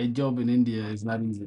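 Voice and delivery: normal talking voice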